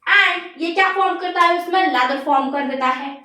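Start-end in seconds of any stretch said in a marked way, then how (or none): none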